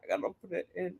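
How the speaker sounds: words round a stitch marker; mouth full. Real, not acted. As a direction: talk with something in the mouth. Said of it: "stitch marker in my mouth".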